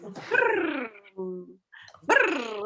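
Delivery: buzzing lip-trill "brrr"; imitated vibrating phone. Host mimics a mom's phone on vibrate, buzzing imitation. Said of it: "the vibrating noise".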